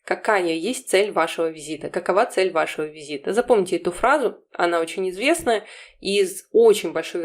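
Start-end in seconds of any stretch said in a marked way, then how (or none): none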